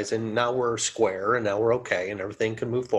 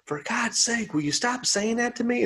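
exasperated; 12 semitones higher than usual. Retold or imitated. Exasperated friend snaps back.

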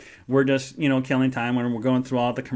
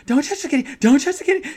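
very high, louder mocking squeal; sing-song squeal; 12 semitones higher, 5 dB louder, faster than usual. Joking with the friend at the desk, high squeal. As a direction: squeal the running joke. Repeated running joke.

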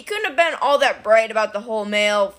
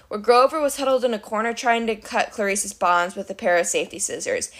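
plain reading voice

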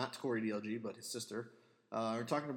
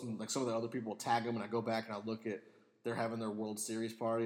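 ordinary talk, nothing marked

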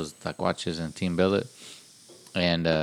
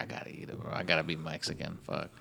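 whispered under the breath, much softer; resigned whisper; 2 semitones lower, 10 dB quieter than usual. Retold or imitated. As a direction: mutter it to yourself, giving in. Retold inner resignation.

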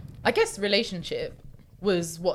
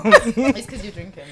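laughing tease; 4 semitones higher, 11 dB louder than usual. Real, not acted. Teasing, laughing.

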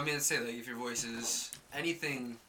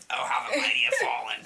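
mock taunt; 6 dB louder than usual. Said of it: "He said".

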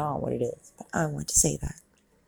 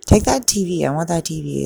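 excited loud demand; 10 dB louder, faster than usual. Real, not acted. Excited over TV in movie.

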